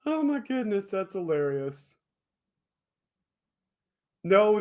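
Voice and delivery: ordinary delivery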